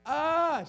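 loud, high cry of pain; sudden acted scream; imitated scream. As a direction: imitate a woman crying out. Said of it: "she starts screaming".

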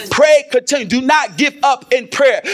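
fiery sermon climax; preaching at full force. Acted. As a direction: drive it home to the congregation.

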